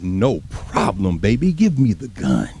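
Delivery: cool soldier brothers. Retold or imitated. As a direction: play the calm volunteer taking the gun. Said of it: "them brothers is like".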